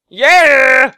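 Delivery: loud, high victory yell; triumphant shout. Real, not acted. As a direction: cheer it out loud. Cheering at getting wood.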